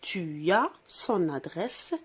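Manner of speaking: slow, deliberate model sentence; model answer. Shown as a demonstration. Teacher to children, slow model sentence. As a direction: say it slowly so pupils repeat. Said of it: "qu'est-ce qu'il a dit?".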